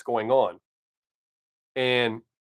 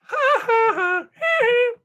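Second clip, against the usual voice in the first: very high, squeaky burst of laughter; high shrieking laugh at his own joke; squealing laugh; 3 dB louder than usual. Real, not acted. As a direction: let a shrieking giggle escape. Squeals after teasing viewer.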